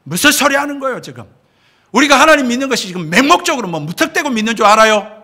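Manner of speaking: sharp, high and loud rebuke; angry outburst. Retold or imitated. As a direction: retell the pastor snapping back at the doctor in anger. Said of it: "막 버럭 화를 내면서".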